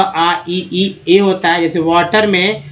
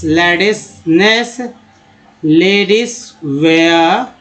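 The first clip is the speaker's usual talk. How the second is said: slow sounding out; much slower than usual. Shown as a demonstration. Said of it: "teacher sounding out words".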